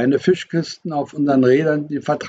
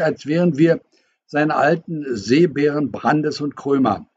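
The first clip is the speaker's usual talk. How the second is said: ordinary delivery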